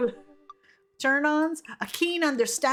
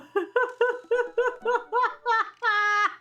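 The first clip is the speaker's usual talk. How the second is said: helpless laughing fit; 11 semitones higher than usual. Real, not acted. Reacting to a grave-robbing answer.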